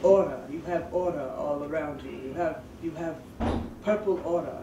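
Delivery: a low, hushed put-on Greek accent, like a street psychic; a stranger's mystic Greek accent, lightly imitated; mock Greek accent. Street psychic warning a passerby, in a soft put-on Greek accent. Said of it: "so I'm gonna say she sounded like this".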